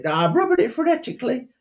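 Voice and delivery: high, fast squeaky Cockney reply; mock Cockney urchin. Urchin assuring his master he is polishing, squeaky Cockney. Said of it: "I heard your Cockney accent".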